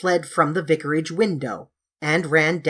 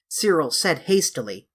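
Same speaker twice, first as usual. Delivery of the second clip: usual speaking voice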